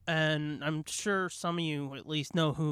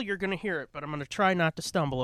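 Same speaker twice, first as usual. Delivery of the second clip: ordinary talk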